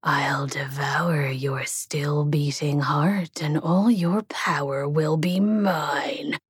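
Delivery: slow menacing demon whisper; a demon hissing a threat; growling demon. Acted. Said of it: "the demon growled".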